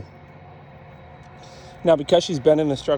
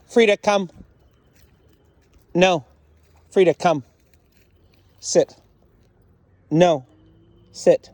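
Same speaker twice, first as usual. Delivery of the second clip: short, higher-pitched orders with pauses; firm dog commands; 5 semitones higher, 4 dB louder, slower than usual. Real, not acted. Trainer corrects Frida during obedience test, firm commands. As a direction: drill the dog one word at a time.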